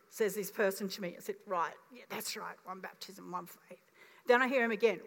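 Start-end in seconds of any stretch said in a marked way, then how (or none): 1.5-3.8 backing down quietly to a friend; meek. Retold or imitated. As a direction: lower and much softer agreement. Meek agreement with the person.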